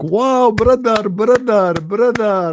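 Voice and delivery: high, slow, drawn-out mock awe on brother. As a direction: ham up a gushing fan praising a brother. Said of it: "they're like".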